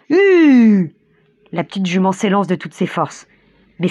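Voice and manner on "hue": long, loud, high driver's shout; grand cri. A narrator's reading. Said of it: "On entend un grand". (grand cri de charretier, haut et traînant)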